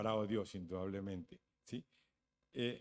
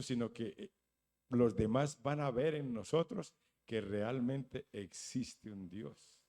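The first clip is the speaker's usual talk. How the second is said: usual speaking voice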